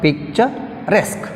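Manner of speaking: Picturesque drawn out, pause before stressed RESQUE; stress on last syllable. Shown as a demonstration. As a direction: slowly split Picturesque and lean on resque. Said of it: "we have stress mark on".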